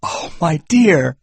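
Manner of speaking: put-on a husband startled to hear of a baby; surprised. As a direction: caught off guard by the news.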